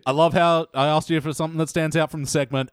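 ordinary talk

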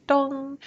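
soft, high bell chime; gentle mock gong, held and ringing; imitated bell. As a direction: hum out one long, steady yoga-bell tone. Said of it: "kind of like a bell".

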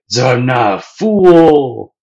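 loud, slow, rising resolve; triumphant declaration. Retold or imitated. Sanji realising his purpose, loud and slow. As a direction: proclaim it with new conviction. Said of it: "Sanji's like".

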